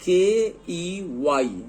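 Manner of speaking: के ई वाई spelled letter by letter, slowly; slow spelling out. Shown as a demonstration. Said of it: "दूसरा example देखिए". (अक्षर-अक्षर धीरे बोलकर वर्तनी)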